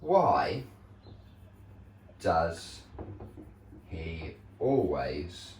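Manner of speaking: very slow, word by word, fading out; slow dictation. Shown as a demonstration. Teacher dictating a question for pupils to write, very slow. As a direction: spell it out slowly for the class. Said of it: "now I'm gonna put here".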